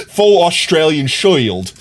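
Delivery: slow, hammed-up Aussie accent; harsh Australian accent. Streamer joking about going full Australian, harsh Australian accent. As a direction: draw it out in mock-broad Australian. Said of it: "Australian accent I could muster".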